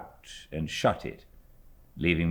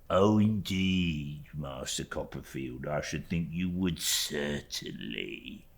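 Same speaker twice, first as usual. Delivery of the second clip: smooth, slow humble insistence; lightly voiced bland clerk; bland Uriah reply; 4 semitones lower, 7 dB quieter, slower than usual. A narrator's reading. Uriah blandly assuring David, slow and smooth. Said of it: "blandly replying".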